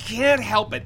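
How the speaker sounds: usual speaking voice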